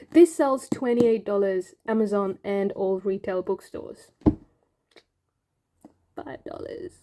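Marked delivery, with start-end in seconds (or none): 6.1-6.9 breathy whispered aside. Real, not acted. Whispering to viewers about the bargain price.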